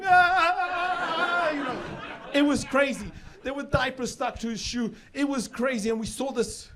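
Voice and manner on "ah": high, loud helpless wailing scream; mock child wail. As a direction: howl like a kid stuck on his back. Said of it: "trapped tortoise on his back just going".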